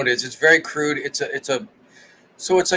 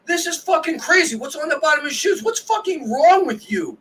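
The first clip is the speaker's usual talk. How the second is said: very high-pitched, imitated furious rant; mock losing it; 12 semitones higher than usual. Co-host imitating friend yelling at a mother, mock losing it. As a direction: rant like someone flipping out, hammed-up. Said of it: "Like, you would lose it".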